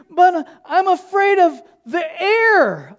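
high, slow, drawn-out whine of fear; hammed-up high fearful protest; mock frightened kid. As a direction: imitate a scared kid stalling. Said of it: "like, having a panic attack up there".

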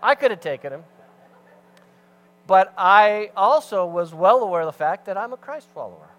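loud, rising preacher's push; preaching at full force. Acted. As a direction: drive it home to the congregation.

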